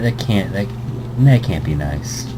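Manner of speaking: ordinary talk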